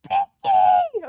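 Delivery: very high, loud wail of the name; anguished wail. Real, not acted. Reader wailing for a dead favorite. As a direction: cry the name to the sky.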